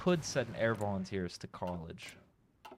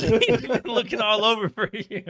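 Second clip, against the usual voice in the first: mock creepy roommate; 10 semitones higher, 9 dB louder than usual. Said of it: "He said".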